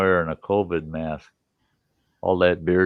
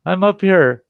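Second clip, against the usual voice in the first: louder, higher call from the tree; 11 semitones higher, 8 dB louder than usual. Retold or imitated. Man in the tree answering the neighbor, calling down loud. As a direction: shout down to the neighbor. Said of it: "I said".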